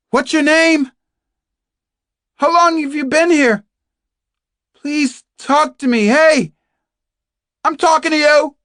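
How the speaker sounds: high, insistent yell through a door; Kate McTiernan shouting. Acted. Captive demanding a reply from an unseen fellow prisoner, shouting. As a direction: shout to force an answer. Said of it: "she shouted".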